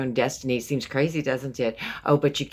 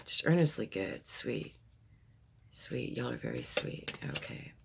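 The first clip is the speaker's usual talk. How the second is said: soft, tender praise; 3 semitones lower, 11 dB quieter than usual. Real, not acted. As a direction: speak it gently, as if to someone dear.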